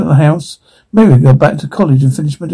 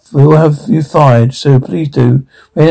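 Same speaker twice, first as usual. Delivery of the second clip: ordinary delivery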